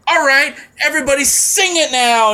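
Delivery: high-pitched performer rallying a crowd; imitated singer's sing-along cue; stage call-out. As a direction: call out like a singer working the audience.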